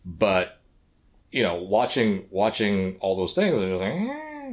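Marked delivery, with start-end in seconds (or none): 3.9-4.5 dismissive grunt. Retold or imitated. Dismissive reaction quoted.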